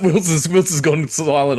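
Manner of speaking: high, laughing speech. Real, not acted. Host teasing the co-host gone silent, laughing. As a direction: talk through real laughter. Laughing after the pun flops.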